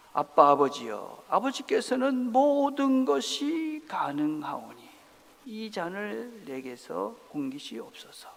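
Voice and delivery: slow, pleading prayer, loud start then hushed; Jesus praying. Acted. Jesus pleading with the Father in prayer, slow and earnest. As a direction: beg the Father slowly, voice sinking. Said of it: "그리고 기도하십니다".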